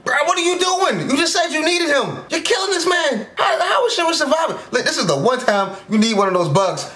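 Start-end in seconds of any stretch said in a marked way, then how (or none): 0.1-4.6 shocked outcry. Real, not acted. Shocked by character's attack.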